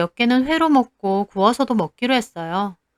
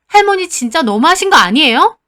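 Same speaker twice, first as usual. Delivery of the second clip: louder and higher, exasperated; angry outburst; 6 semitones higher, 5 dB louder than usual. Retold or imitated. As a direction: finally let it burst out. Girl blows up at grandmother.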